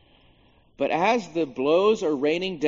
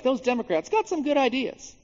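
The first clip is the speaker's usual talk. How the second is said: high-pitched, agreeable mock pundit; Fox News; 10 semitones higher than usual. Imagined cable news praising its opponents, in mock high voice. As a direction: pitch it way up, conceding to the other side. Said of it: "Fox News would be like".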